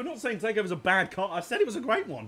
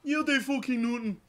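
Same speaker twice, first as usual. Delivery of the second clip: higher, gruff South London dad; dad impression; 8 semitones higher than usual. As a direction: bark it like an angry dad. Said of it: "Actually, my dad".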